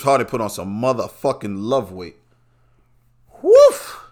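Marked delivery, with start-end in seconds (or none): 3.4-4.0 sudden yelp of a woof, way up high; a barked woof to cap off the joke; loud high woof. Real, not acted. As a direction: bark out a big woof. Loud bark-like exclamation.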